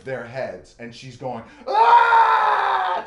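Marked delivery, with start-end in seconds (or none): 1.7-3.0 put-on long high piercing drunken scream. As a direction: shriek at the top of the lungs. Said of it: "just screaming her head off".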